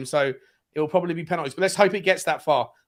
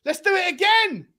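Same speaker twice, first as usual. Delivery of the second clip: high, loud, drawn-out rallying call; rousing call; 11 semitones higher, 6 dB louder than usual. Real, not acted. Fan wanting another attack, rousing shout. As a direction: stretch it out high like a rallying cry. Urging team on live.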